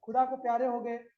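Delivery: normal talking voice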